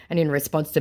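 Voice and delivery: ordinary talk, nothing marked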